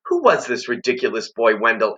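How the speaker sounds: usual speaking voice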